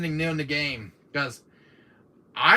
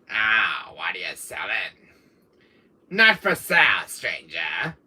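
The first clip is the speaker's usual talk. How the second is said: low villainous shopkeeper voice; put-on low merchant impression; Wesker as The Merchant; 8 semitones lower, slower than usual. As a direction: play a shady trader, dropping low at the end. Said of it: "Here's my Wesker impression of The Merchant".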